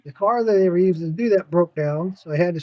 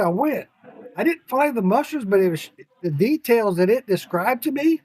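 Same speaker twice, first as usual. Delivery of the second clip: normal talking voice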